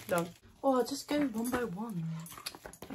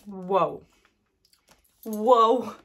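amazed whoa; 9 dB louder than usual. Real, not acted. Tasting strong flavour.

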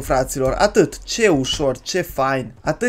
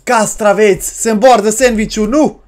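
loud, raised voice climbing to the end; mock frenzy; 4 semitones higher, 5 dB louder than usual. Player overloading a sandwich, loud climbing mock frenzy. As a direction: build to a high, loud last word.

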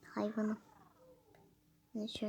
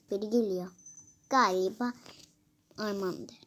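usual speaking voice

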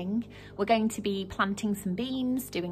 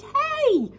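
high, loud startled shout; giant's shout; 8 dB louder than usual. Acted. The giant shouting as the beanstalk tumbles, loud. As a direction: yell it as he falls. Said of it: "The giant shouted".

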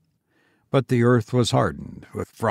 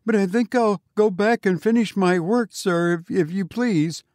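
high, nervous babbling; frightened; 7 semitones higher than usual. A narrator's reading. Frightened sexton.

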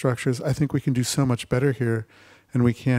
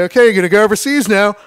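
higher, louder commanding officer; officer's order; 9 semitones higher, 10 dB louder than usual. Retold or imitated. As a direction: bark it like a trench officer. Officer sending troops over.

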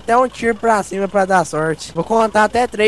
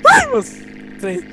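startled squeal. Real, not acted. Sudden fright in game.

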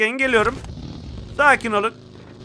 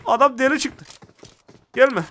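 ordinary delivery

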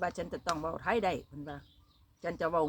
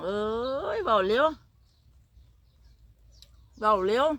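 louder quoted complaint; 3 semitones higher, 4 dB louder than usual. Retold or imitated. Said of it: "เขาบอก".

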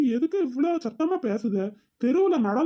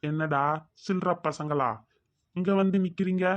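low bully voice; 6 semitones lower than usual. Acted. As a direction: lean in low like a local bully. Bully claims his area.

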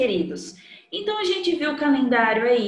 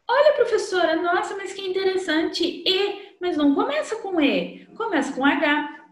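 teacher mimicking a surprised student, pitched high; amazed pupil; 7 semitones higher than usual. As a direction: play a wide-eyed kid discovering something.

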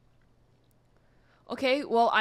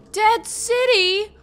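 high, loud, drawn-out question; alarmed disbelief; 9 semitones higher, 8 dB louder, slower than usual. Real, not acted. Gamer learning the city's name, alarmed disbelief. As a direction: repeat it slowly, louder, in disbelief.

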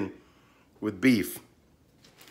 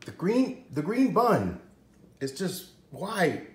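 incredulous; 8 semitones higher, slower than usual. Real, not acted. Disbelief at green bun.

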